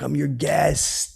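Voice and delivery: put-on slow, over-careful drunk words; drunk over-enunciation. Tipsy guest repeating himself to Mickey, slowly over-enunciated. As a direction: stretch every syllable like a tipsy man. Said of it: "over enunciate".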